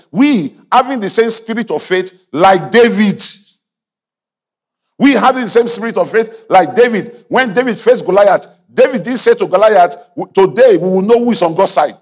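high, loud preaching build. Acted. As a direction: drive it home to the congregation. Preaching at full force.

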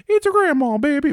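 put-on high, sassy old granny voice; sassy grandma voice. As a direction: coo it like a cheeky granny. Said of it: "with the old ... voice".

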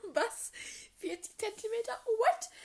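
high, loud incredulous yelp; disbelief. Retold or imitated. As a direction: gasp it out in disbelief. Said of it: "denk mir so".

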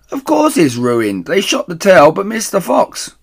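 loud, high scornful reply; calling down. Acted. Missus Bean calls down scornfully, loud and high. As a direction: set her straight from upstairs.

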